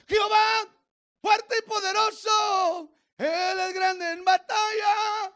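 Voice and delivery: high, loud, slow shouted praise; full-force preaching. Real, not acted. Pastor shouting praise over the congregation. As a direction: preach it at the top of your voice.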